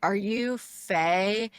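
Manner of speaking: slow, drawn-out careful question; imitated hesitant approach; tentative. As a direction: ask haltingly, unsure it's them. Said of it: "like, really tentatively to me".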